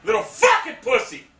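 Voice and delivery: high-pitched, incredulous yelling; angry outburst. Real, not acted. Gamer cursing the player who killed him, furious shouts. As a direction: hurl insults at the opponent. Raging at an opponent.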